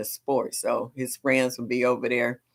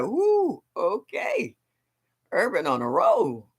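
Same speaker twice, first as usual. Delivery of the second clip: impressed ooh; 5 semitones higher, slower than usual. Retold or imitated. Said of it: "I'll be like".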